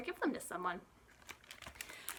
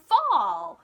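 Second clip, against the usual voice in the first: excited answer; 8 semitones higher, 13 dB louder than usual. Acted. Answering her own question to kids.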